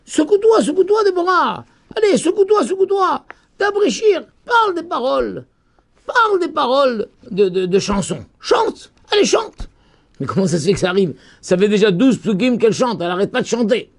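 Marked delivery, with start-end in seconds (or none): 0.1-7.1 high-pitched exhortation; urgent urging. Acted. Teacher voicing a call to Deborah to wake and sing, urging. 8.4-9.7 high, insistent prodding; urgent urging. Acted. Teacher voicing a call to Deborah to sing, urging.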